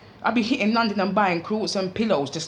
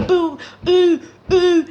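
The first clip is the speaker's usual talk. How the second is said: mock moaning noises; 10 semitones higher, 8 dB louder, slower than usual. Mimicking noises heard upstairs.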